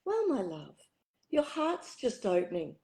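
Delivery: soft gentle reassurance; soothing, tender and quiet. Retold or imitated. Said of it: "in her beautiful, gentle way".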